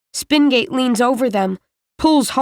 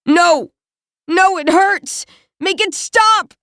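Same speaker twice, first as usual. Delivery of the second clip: high, loud wail of pain; pained cries; 7 semitones higher, 3 dB louder than usual. A narrator's reading. Yang begging them to make the pain stop, high wail. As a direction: plead through the hurt. Wounded boy crying in pain.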